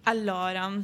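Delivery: usual speaking voice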